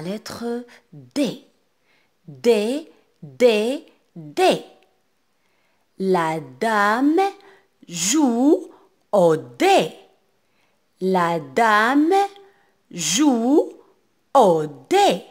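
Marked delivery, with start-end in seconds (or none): 2.3-4.6 loud, separate repetitions for pupils to echo; rising repeats, then a closing fall; read-along drill. Shown as a demonstration. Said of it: "lisez avec moi". 6.0-15.2 loud, chunked reading with pauses between word groups; projected, deliberate teacher reading; read-along model. Shown as a demonstration. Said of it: "lisez avec moi".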